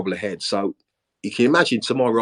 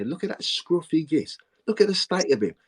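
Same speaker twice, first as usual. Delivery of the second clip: mock disdain; 8 semitones higher than usual. Manager imitates neighbours judging player, mock disdain. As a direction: imitate neighbours looking down on someone.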